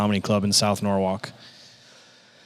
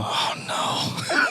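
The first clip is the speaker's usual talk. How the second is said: hushed, voiceless retold dread; dreading whisper; 4 dB quieter than usual. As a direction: whisper it as if caught out. Singled out by blunt comedian.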